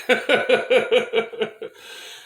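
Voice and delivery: loud cackling laugh after the jab; real burst of laughter. Real, not acted. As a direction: laugh out loud at your own insult. Laughing at his own jab.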